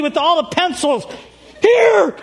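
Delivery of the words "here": high, loud panicky yelp; panicked. Retold or imitated. Donnie runs up with the stolen pencils, panicked yelp. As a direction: blurt it out in a mock panic. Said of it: "He panics".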